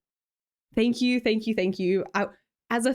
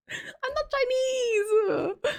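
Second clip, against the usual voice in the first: slow, high, breaking into laughter; giggling denial; laughing protest; much slower than usual. Real, not acted. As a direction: laugh it out in disbelief.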